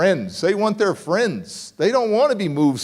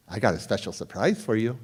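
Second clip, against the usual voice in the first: ominous mother; 7 semitones lower, 7 dB quieter than usual. Retold or imitated.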